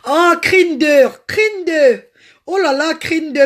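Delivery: high, loud, slow drawn-out name; delighted call-out. Real, not acted. Greeting a viewer live.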